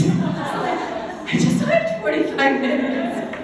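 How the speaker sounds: laughing through the words in disbelief; slow, breathy laughing disbelief. Real, not acted. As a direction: laugh it out, can't believe it. Laughing at the long hike.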